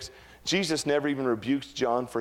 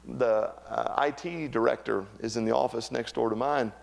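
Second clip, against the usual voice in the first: usual speaking voice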